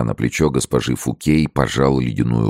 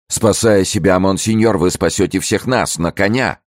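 high, insistent plea; urgent urging; 7 semitones higher than usual. A narrator's reading. Pelisson urging monseigneur to save all, urgently. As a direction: keep the pitch up and push him to go.

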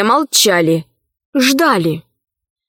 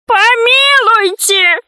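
high, drawn-out plea; crying out; 11 semitones higher, 3 dB louder, slower than usual. A narrator's reading. Pygmy prisoner begs the judges for mercy, crying out. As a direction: cry out for mercy, pitched way up. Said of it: "закричал Вэри Мэри".

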